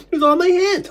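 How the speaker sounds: high, loud tearful wail; real anguished sobbing outcry. Real, not acted. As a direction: cry the words out through sobs. Sobbing as the memory surfaces.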